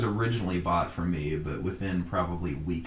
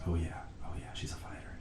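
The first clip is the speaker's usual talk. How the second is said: hushed whispered aside; 10 dB quieter than usual. Retold or imitated. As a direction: whisper it like a secret. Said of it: "and my mom goes".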